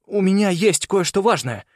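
high, pressing plea; urgent bargaining. A narrator's reading. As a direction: bargain for your life. Boy bargains for his life.

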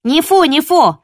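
high, loud chiming yap; second pup backs her up; yappy little dog. Acted. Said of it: "поддержала Копа".

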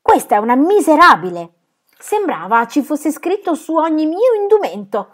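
loud, high indignation; indignant. Acted. Phyllis rails against the aunt, loud and high. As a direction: spit out her outrage.

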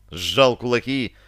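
usual speaking voice

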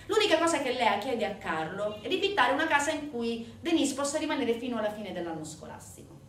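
usual speaking voice

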